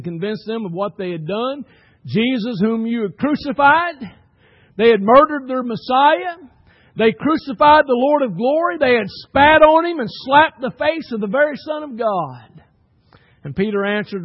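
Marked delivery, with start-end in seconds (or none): 2.1-12.6 higher, louder pulpit indictment; impassioned preaching. Acted. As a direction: build the charge against the crowd, phrase by phrase. Preacher recounts the crucifixion accusingly.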